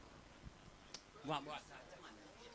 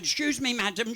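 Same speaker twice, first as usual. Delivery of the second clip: officer's voice; 8 semitones higher than usual. Retold or imitated. Customs officer stops old lady.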